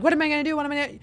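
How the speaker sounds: panicked inner voice. Retold or imitated. Host voicing her anxious inner worry voice, high and frantic. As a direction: squeak it out like the worry voice in your head. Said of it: "that background voice be like".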